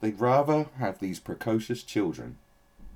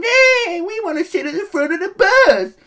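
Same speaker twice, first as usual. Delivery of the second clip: put-on very high, loud crying kid voice; hammed-up sobbing child demanding rights; mock whiny kid; 15 dB louder than usual. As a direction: screech it like a bratty twelve-year-old. Mocking a child activist speaking.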